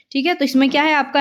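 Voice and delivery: ordinary talk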